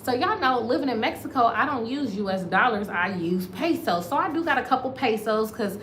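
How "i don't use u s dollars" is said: normal talking voice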